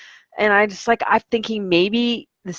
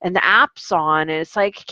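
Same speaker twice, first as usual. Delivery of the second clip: ordinary talk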